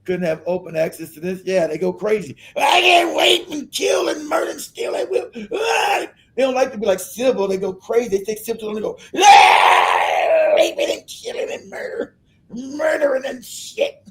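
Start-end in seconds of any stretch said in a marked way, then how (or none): 2.6-6.1 shouting, crying out high and slow; frenzied mock rioter. Mocking rioters yelling wildly. 9.2-14.1 high yell fading into a slow breathy rant; crazed mock rioter. Mocking rioters ranting about murder.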